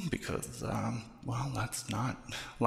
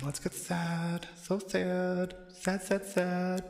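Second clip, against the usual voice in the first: mock-mournful, higher and slow; hammed-up sad; 6 semitones higher, much slower than usual. Actress forcing herself to sound sad, a hammed-up higher moan. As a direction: overplay the sadness like a bad actress. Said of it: "Okay, get sad".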